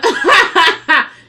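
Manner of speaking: loud, high burst of laughter; real laughing outburst. Real, not acted. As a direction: crack up at your own bit. Cracks up at own reenactment.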